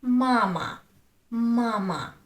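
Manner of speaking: MA-ma, loud long first syllable in "mama"; stress on first syllable. Shown as a demonstration. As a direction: lean on the first "ma" of "mama", second short. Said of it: "The first one".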